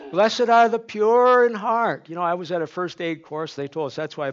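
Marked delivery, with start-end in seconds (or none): 0.1-2.1 higher, louder and slow, every word weighted; preacher at full force; climactic proclamation. Acted. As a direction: build the verse to its peak. Climactic repeat of the verse.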